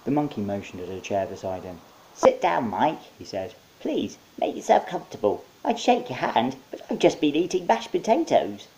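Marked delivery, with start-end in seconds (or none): 2.1-3.0 high monkey voice inviting. Acted. Monkey greeting Mike in his office. 3.8-8.7 high, cheerful monkey; chirpy host monkey. Acted. Monkey welcoming Mike with potato hands.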